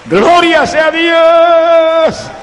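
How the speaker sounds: high, loud, drawn-out praise cry; exultant shout of praise. Real, not acted. Preacher praising God before the congregation, exultant shout. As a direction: shout it slowly to the rafters.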